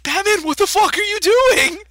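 high, incredulous outburst; mock disbelief. Everyone watching Batman drink from the car, mock disbelief. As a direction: cry out in exaggerated bafflement.

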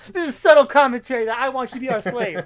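high, loud, fast hammed-up threat; mock villain threat. Retelling the comic, gloating the villain's demand loudly. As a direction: gloat the blackmail like a cartoon villain.

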